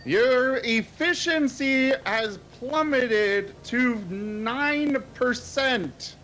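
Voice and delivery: slow, high, mechanical readout; robotic announcer voice. Pretend. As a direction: announce it like a machine. Reading out game efficiency stat.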